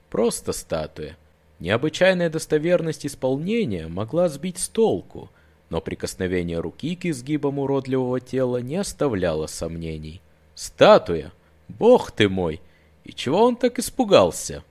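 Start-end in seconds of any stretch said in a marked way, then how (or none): 0.1-1.2 slow, low, self-calming; reassured. Acted. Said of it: "Это сразу его ободрило". 10.5-11.3 loud startled question; alarmed. Acted. Same word, alarmed doubt. 11.7-12.6 loud gasping oath; startled exclamation. Acted. Frightened exclamation.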